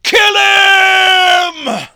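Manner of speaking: high, very loud barked command; barked order. Acted. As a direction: bark the kill order like a cruel gang boss. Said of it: "barked the order".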